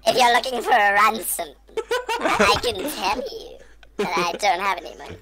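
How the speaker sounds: squeaky Taken threat with laughter; Taken speech with voice changer. Acted. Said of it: "Liam Neeson".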